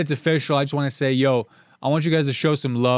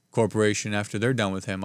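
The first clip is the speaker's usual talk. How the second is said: ordinary talk, nothing marked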